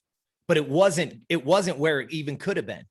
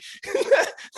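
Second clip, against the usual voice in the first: high breathy laugh. Real, not acted. Laughs at his own retelling.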